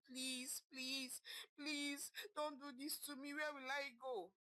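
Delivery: high, rising, desperate begging; wife begging as she is thrown out, desperate and tearful; crying plea. A narrator's reading. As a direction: plead not to be thrown out. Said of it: "she said all at once, crying".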